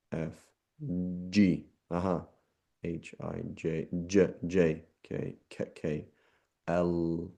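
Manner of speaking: letters slowly spoken one by one, testing voicing; alphabet demo. Shown as a demonstration. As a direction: sound each letter to feel the buzz. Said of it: "put your fingers in your ears".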